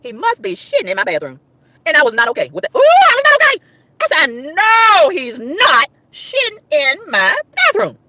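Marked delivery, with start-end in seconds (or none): 2.8-3.6 high-pitched exclamation of outrage; horrified outburst. Real, not acted. Woman realising what mover is doing, horrified. 4.0-8.0 slow, drawn-out whine of disbelief; outraged disbelief. Retold or imitated. Woman aghast at mover in her bathroom, outraged.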